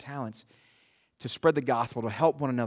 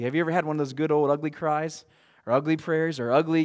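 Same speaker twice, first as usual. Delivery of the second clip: usual speaking voice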